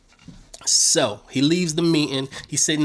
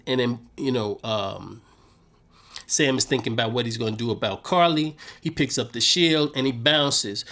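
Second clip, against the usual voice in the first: ordinary talk, nothing marked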